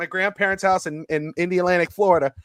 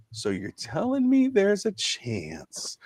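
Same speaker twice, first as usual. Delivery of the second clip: a hint of a slow, hopeful movie-line delivery, softer; Jim Carrey quote; 9 dB quieter, slower than usual. Host quoting the comedy line about playoff hopes, mild impression. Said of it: "as the great Jim Carrey would say".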